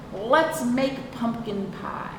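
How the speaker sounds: usual speaking voice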